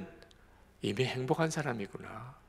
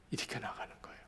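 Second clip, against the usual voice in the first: hushed whisper; 14 dB quieter, much faster than usual. Real, not acted. Preacher trails into a whisper.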